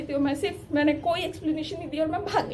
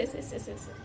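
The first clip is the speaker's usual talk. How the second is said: whispered sobbing demo; 2 semitones lower, 11 dB quieter, faster than usual. Shown as a demonstration. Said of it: "मेरे ऐसे".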